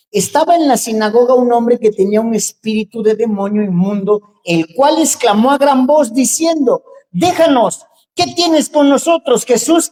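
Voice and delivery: scripture read at full preaching force; fiery scripture reading. Acted. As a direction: proclaim the passage with rising fire. Reads the Bible passage aloud.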